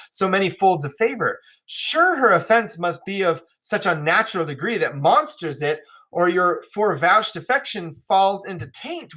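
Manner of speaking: stagey, astonished royal speech; King of France, incredulous. Acted. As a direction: declaim as a suitor baffled by her disgrace.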